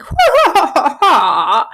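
high shrieking witch cackle, slowing down; cackling Wicked Witch of the West. Retold or imitated. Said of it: "And she's like".

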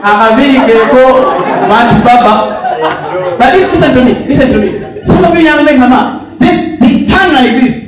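high, loud shout to the crowd; full-force preaching. Real, not acted. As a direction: holler it out over the congregation's laughter. Shouts to a laughing congregation.

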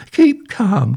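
high, loud panicky squeak. A narrator's reading. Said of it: "squeaked Harold".

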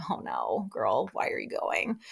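low, hushed letdown; disappointed friend. Retold or imitated. Let-down friend watching her make that mistake, disappointed. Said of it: "so disappointed".

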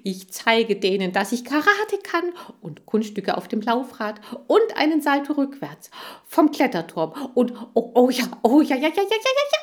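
high, loud, escalating eagerness; overexcited hamster, building to a squeal; freaking out with excitement. A narrator's reading. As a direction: gush until it turns into manic yelping. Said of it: "schon flippt er aus".

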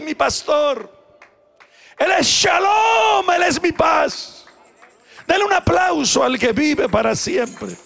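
loud, high shouted praise; full-force preaching. Acted. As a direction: cry each name of God to the rafters.

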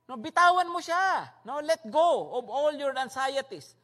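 normal talking voice

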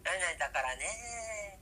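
stretched out slow, each syllable lingering; slow drawn-out dialect demo. Shown as a demonstration. A Hiroshima speaker slowly showing the dialect ending. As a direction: lay it out slowly like a dialect lesson. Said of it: "みたいな感じで言う時もあります".

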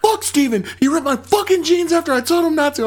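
high, indignant retold gripe; angry complaint. Acting friend's angry complaint.

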